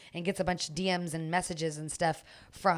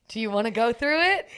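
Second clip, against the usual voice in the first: a hint of a sly tempter, louder; tempting inner voice; 4 semitones higher, 7 dB louder than usual. Girlfriend tempted to look through the phone, lightly voiced. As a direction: tease the idea out loud. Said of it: "that moment of truth".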